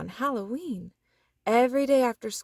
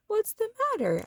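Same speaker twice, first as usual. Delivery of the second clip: higher caring mother; mother's voice; 13 semitones higher, faster than usual. A narrator's reading. Mother asking sad Froggy what happened, caring voice. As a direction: ask gently what's wrong.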